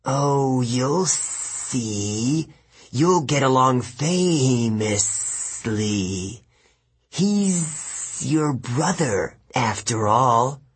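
slow, sly serpent voice; hissing snake woman. Acted. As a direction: tease it out like a snake. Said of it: "She hissed".

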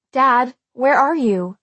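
slow, loud, searching call. A narrator's reading. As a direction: call anxiously for someone out of sight. Searching aloud for her hidden father.

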